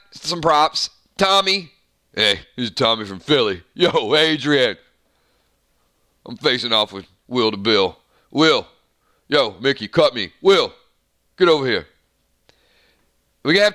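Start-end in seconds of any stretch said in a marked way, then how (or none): 2.1-11.9 lightly voiced Philly boxer, lower; a hint of Rocky from Philadelphia; Philly tough guy. Tommy's video, Philly boxer voice.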